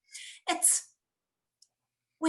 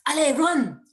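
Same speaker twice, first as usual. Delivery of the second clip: higher, louder command; urgent shout; 6 semitones higher, 9 dB louder than usual. Retold or imitated. Instructor shouting at the pair to run, urgent shout. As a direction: yell it to launch the run.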